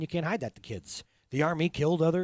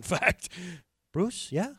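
usual speaking voice

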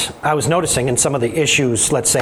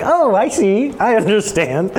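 mock realization; 6 semitones higher, slower than usual. Retells a sudden realization, amused.

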